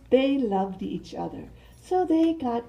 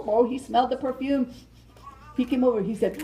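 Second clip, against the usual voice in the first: ordinary delivery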